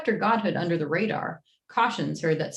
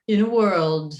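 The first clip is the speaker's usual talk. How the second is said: louder, dramatic trailer opener; movie trailer voice; 2 semitones higher, 6 dB louder than usual. Pretend. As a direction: boom it out like a trailer. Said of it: "Every single one will start in a world".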